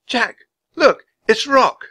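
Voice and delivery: high, loud exclamation; high-voiced little girl calling out; excited girl. A narrator's reading. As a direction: point out a discovery urgently. Annie excitedly spots the bird.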